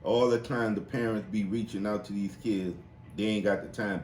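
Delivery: usual speaking voice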